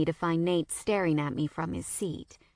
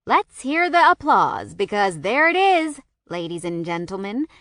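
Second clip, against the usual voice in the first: announcer voice; 4 semitones higher, 5 dB louder than usual. A narrator's reading. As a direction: hype it like a ringmaster. Mock sports announcer.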